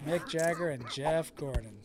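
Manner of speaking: names said softly in a Southern accent. Shown as a demonstration. Repeating mom's favorites in a soft Southern accent. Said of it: "What did I say that's from?".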